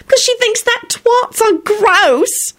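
very high, slow, emphatic squeal; excited outburst. A narrator's reading. Solange oversharing in public, high and excited. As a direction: stretch the scandal out shrilly high. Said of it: "she burst out excitedly".

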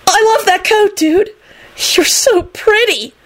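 high, drawn-out lovestruck gush; besotted dude squeal; lovesick. A narrator's reading. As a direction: swoon in a high, smitten voice. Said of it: "he added in a lovesick tone".

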